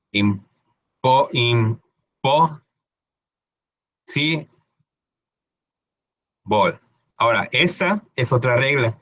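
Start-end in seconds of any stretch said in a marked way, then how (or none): 0.1-6.8 Impo- si- bol broken into slow separate syllables; syllable-by-syllable demo. Shown as a demonstration. Teacher spells out the sounds for students. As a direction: break Impossible into syllables with pauses. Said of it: "la L E se va a convertir en ol".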